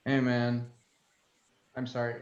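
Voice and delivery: ordinary talk, nothing marked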